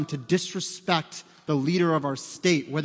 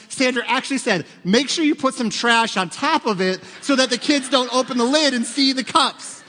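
loud, higher, driving preacher voice, a bit fast at first; full-force preaching; 6 semitones higher, 6 dB louder than usual. Acted. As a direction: preach it out to the whole room.

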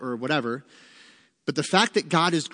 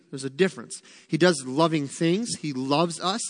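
ordinary talk, nothing marked

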